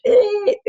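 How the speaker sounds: short, high-pitched whiny "eh"; whiny squeal. Retold or imitated. Parent mimics kid's voice for class, whiny squeal. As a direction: squeak out a loud little whine. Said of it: "this whiny, high-pitched".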